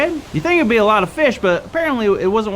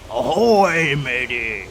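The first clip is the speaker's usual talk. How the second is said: pirate voice; 10 semitones lower, much slower than usual. Shown as a demonstration. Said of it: "to talk like a pirate".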